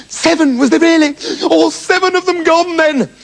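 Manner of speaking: high, startled "Seven" falling away; surprised mother. Acted.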